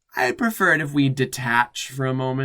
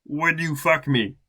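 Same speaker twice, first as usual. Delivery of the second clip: flat character read; 3 semitones higher, 5 dB louder than usual. Acted. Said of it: "No question mark".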